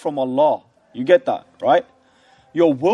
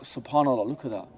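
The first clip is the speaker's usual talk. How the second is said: softer, hushed marvelling; quiet awe; 5 semitones lower, 7 dB quieter, faster than usual. Retold or imitated. Teacher voicing a student's hushed amazement, softer. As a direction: murmur it as if amazed at what you see. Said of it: "Then you're like".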